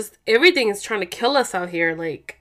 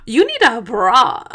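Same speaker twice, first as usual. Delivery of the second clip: loud, drawn-out mock insistence; teasing retort; 3 semitones higher, 7 dB louder, slower than usual. Wife teasing husband about his chest, loud mock insistence. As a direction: tease him loudly, stretching the words out.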